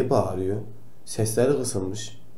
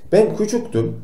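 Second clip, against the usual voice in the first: put-on pitched up high like a small kid; little-child voice; 10 semitones higher than usual. Doctor telling kids about his childhood, in a high child voice. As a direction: say it as your younger self, high.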